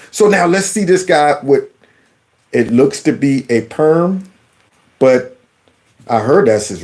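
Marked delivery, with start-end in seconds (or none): none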